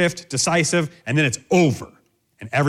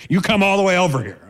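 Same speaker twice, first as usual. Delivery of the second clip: higher, forceful parental command; stern order with raised pitch; firm parent; 6 semitones higher, 4 dB louder than usual. Acted. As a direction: insist firmly, as to a disobedient child. Parent insisting to disobedient child.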